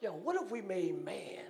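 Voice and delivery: God voiced as a relaxed friend; casual God voice. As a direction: pitch the idea like a buddy. God voiced casually planning man.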